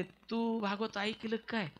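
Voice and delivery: soft, slow, doubtful king; the king asking the maid softly, half in doubt; a soft, slow question from the king; a touch of doubt. Acted. King answers servant doubtfully.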